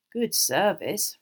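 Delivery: drawn-out approval; impressed. A narrator's reading. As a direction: say it slowly, clearly impressed. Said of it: "Ron said in an impressed voice".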